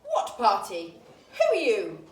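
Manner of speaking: loud, sharp snap; snapping queen. A narrator's reading. Queen of Hearts snapping at Alice. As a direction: bark it at the intruder. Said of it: "snapped a voice".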